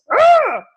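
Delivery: sudden loud high groan of rage; frustrated ugh. Retold or imitated. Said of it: "I'm in a space of rage".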